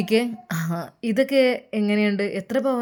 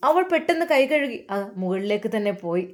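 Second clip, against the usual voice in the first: ordinary delivery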